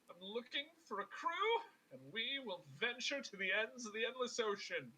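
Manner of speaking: high, pompous proclamation; grandiose adventurer. Acted. Alabaster seeking a crew for the endless ocean, grandiose.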